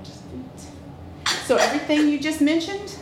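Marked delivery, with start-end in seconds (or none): 0.0-1.4 soft whispered confirmation, nearly voiceless. Retold or imitated. Telling the husband her only wish, in a whisper. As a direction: breathe the word feet as a secret. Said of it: "I'm like".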